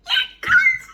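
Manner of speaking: high squealing delight; excited squeal. Real, not acted. Reader squealing to her viewers in delight. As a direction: squeal it out over the book.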